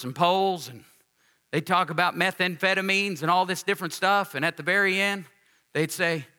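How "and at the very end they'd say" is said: usual speaking voice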